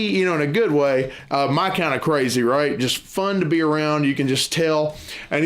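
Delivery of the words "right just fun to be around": normal talking voice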